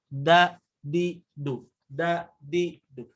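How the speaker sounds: "Da, di, du" with the tongue pressed hard, a firm stop on each. Shown as a demonstration. Teacher drilling the pressed sound, firm and clipped. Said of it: "ditempelkan ke gusi paling depan".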